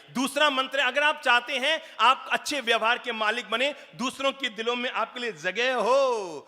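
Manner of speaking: high, loud preacher's call; fiery preaching. Acted. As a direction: drive the lesson home to the crowd. Preaching at full force. (जोशीला प्रवचन)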